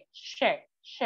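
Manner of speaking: "Sh, Sh" said as a soft, hushed sh hiss; "Sh" as a quiet sh; sh sound. Shown as a demonstration. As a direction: model the Turkish ş as English sh. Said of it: "cara membacanya sama seperti bahasa, eh, Indonesia itu S Y".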